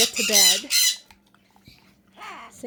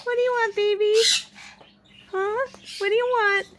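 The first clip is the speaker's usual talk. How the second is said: much higher cooing; 7 semitones higher than usual. Real, not acted. Owner asking her parrot what it wants, high coo. Talking to birds.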